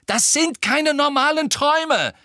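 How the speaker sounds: loud, very high outburst; angry scream. A narrator's reading. Harry rejects Hermine's dream theory, screaming at her. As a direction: yell it right at her. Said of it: "schrie Harry sie an".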